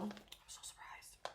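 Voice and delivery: slow, breathy whispered aside. Real, not acted. Telling viewers about passing the exam, slow whisper. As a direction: whisper it like a secret to the camera.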